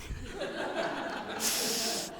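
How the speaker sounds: soft, breathy laugh; real laugh. Real, not acted. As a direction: let a quiet laugh run on. Laughs after the Marine's reply.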